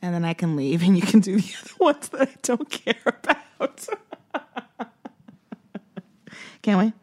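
laughing plea. Real, not acted. Pleading while laughing, loud.

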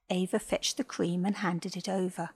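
ordinary delivery

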